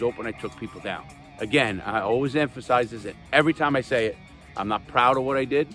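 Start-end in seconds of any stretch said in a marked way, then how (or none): none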